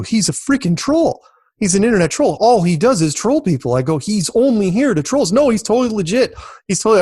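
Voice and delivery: heated protest. Retold or imitated. Retold argument about Logan Paul.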